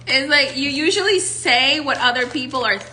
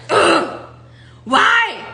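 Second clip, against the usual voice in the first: exasperated; 6 semitones higher, 6 dB louder than usual. Acted. Acted frustration at Geminis.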